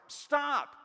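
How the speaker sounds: sharp, high, loud command; urgent shout. Retold or imitated. Dad shouting at his teen driver, loud urgent command. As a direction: bark an order at a learner driver. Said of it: "I tried to explain to them when... imminent danger".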